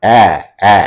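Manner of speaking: "অ্যা, অ্যা" as two separate held open æ sounds; isolated æ vowel. Shown as a demonstration. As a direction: hold "অ্যা" alone, then repeat it after a pause. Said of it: "এই উচ্চারণটা হচ্ছে".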